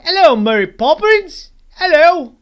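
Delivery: loud, high-pitched, terrible mock Cockney. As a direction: shout it in an exaggerated, badly faked Cockney accent.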